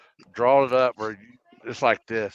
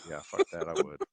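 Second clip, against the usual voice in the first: low muttered aside; 8 semitones lower, 11 dB quieter than usual. Retold or imitated. Teller recalling his own reply to Matt, low and soft. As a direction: lower and softer, under the breath. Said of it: "I was like".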